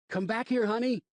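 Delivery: calling out loud and high; a father yelling for his lost daughter; shout. Acted. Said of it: "I shouted".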